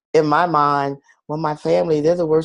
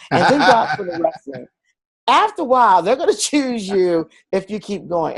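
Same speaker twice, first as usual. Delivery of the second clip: laughing advice; 6 semitones higher than usual. Retold or imitated. Joking advice to sister, laughing.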